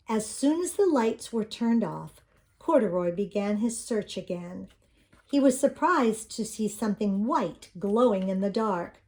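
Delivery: usual speaking voice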